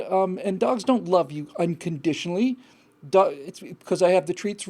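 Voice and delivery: ordinary talk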